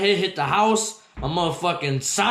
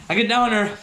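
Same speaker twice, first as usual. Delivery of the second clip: ordinary talk, nothing marked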